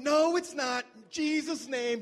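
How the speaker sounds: loud, high, firm faith-declaring shout; mock prayer rebuke. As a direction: command the injury away like a preacher. Friend rebuking injury in prayer.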